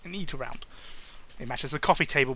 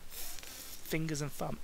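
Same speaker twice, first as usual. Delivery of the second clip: slow; 12 dB quieter, much slower than usual. Shown as a demonstration. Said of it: "all fingers and thumbs".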